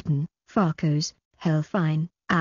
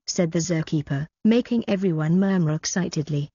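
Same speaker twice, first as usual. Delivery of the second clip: usual speaking voice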